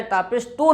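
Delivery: तो with an 'o' instead of 'oo'; majhul vowel, wrong. Shown as a demonstration. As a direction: open the pesh into तो. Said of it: "ये मजहूल आवाज़". (तो वाली मजहूल आवाज़)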